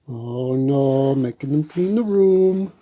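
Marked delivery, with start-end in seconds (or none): none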